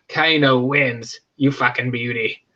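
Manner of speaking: slow, low mock Australian growl; Australian Kano. Kano gloating after ripping out a heart, mock Australian. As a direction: drawl it out in a mock Aussie accent.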